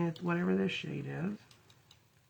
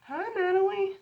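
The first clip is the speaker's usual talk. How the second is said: high cooing voice; 13 semitones higher than usual. Real, not acted. Greeting pet, high.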